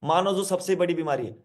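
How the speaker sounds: ordinary talk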